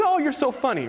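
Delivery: fake-polite girl. Girl on a date humoring a joke, mock gushing. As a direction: gush insincerely at a bad joke. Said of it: "She laughs and is like".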